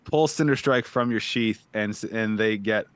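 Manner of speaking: ordinary delivery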